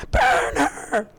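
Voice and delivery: very high, loud shout; mob yell. Acted. Fan screaming the villagers' line, full force. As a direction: scream it like a torch-waving mob.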